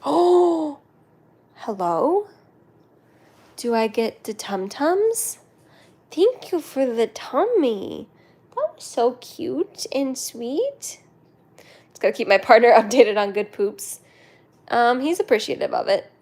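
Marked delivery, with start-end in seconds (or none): 0.0-11.0 slow, cutesy put-on voice; playful character voice. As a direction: draw it out slowly, playing a sweet little character. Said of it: "It's like".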